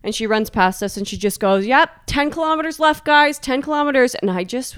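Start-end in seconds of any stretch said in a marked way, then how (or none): 1.7-4.1 high, bouncy cheering call; energetic runner. Retold or imitated. Energetic woman calling out to tired runners as she passes, chirpy. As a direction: mimic an upbeat runner, pitched high. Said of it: "still had energy".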